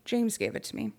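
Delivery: flat, lifeless, dazed reply; monotone voice. Retold or imitated. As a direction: say it with no melody at all. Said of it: "in a monotone voice".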